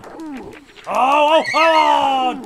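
high, loud startled cries; excited yelps. Real, not acted. Gamer reacting to a near goal with loud high yelps. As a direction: yelp at the close chance.

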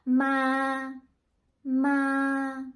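"ma" held level and steady; first Vietnamese tone. Shown as a demonstration. Teacher showing students the first tone, level and steady. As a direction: sing "ma" on one flat even pitch. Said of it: "Just repeat after me".

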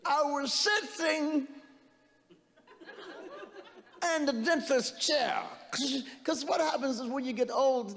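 Cosby-style dentist story; Bill Cosby impression. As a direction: slide up high and take your time, mumbling through. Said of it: "I like to do Bill Cosby".

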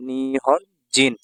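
Nihon জিন drawn out very slowly, syllable by syllable; slow teaching repeat. Shown as a demonstration. Teacher to students, repeating Nihon জিন very slowly.

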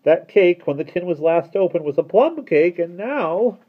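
high, loud reproach; reproachful. A narrator's reading. Mister Turvey blaming Mary for the changed cake, reproachful. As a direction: accuse with hurt indignation. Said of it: "he looked reproachfully at Mary Poppins".

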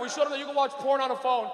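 ordinary delivery

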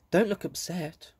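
ordinary delivery

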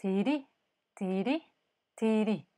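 tiri sung short ti, long ri; Quantz tiri. Shown as a demonstration. Teacher shows the tiri tonguing from the treatise, short-long. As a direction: clip the ti, lean on the ri. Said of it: "what he really describes is".

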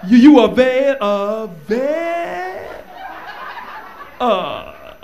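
drawn-out stuttered words, slow and rising; hammed-up stutter ending high and loud on available; mock stuttering compliment. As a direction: stretch each word out, stalling before the last.

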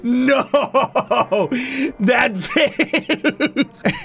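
high, squealed words through laughter; laughing hard. Real, not acted. Player cracks up at the offended character's face, laughing.

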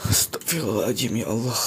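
very low, hushed prayer under the breath. Real, not acted. Vlogger spooked in the dark, hushed whisper prayer. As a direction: murmur it, spooked. Startled in haunted place.

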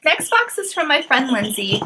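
usual speaking voice

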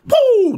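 high, loud whooping getaway noise; zooming "woo". Retold or imitated. Storyteller darting on out of there, high whooped sound effect. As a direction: whoop it like you're darting away.

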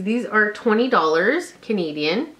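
ordinary talk

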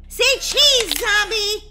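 high, loud photo call; shouted call. Acted. Girl photographing a zombie, loud high shout.

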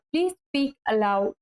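drawn out slowly, word by word; slow, clear teacher's model; slow model sentence. Shown as a demonstration. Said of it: "teacher models slow English". (ধীরে স্পষ্ট করে বলা)

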